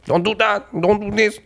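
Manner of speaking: high, lightly voiced feisty scolding woman; feisty Latin woman. Latin women scolding their man, high mock scolding. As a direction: scold like a bossy girlfriend, half laughing.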